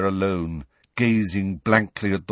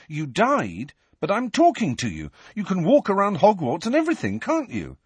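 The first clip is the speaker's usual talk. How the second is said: higher, eager pressing voice; insistent teen Harry; 10 semitones higher than usual. Acted. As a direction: keep pressing him eagerly.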